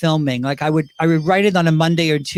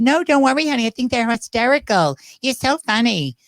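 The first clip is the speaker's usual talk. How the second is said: higher, put-on pop star voice; 4 semitones higher than usual. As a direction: reassure in a raised celebrity impression. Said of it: "that's my [name] impersonation".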